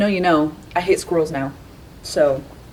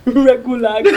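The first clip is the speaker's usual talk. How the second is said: laughing; 6 semitones higher, 3 dB louder than usual. Real, not acted. Tries name, laughing.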